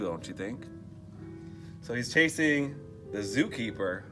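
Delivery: usual speaking voice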